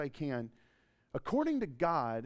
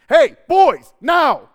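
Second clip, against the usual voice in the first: put-on shouted high and loud at the kids; wife's shout; 11 semitones higher, 22 dB louder, much slower than usual. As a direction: yell it like an angry mom calling her boys. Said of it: "That's how my wife talks".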